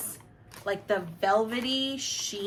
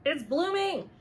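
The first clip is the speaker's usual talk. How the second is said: excited; 7 semitones higher, slower than usual. Real, not acted. Shows the bloom to camera.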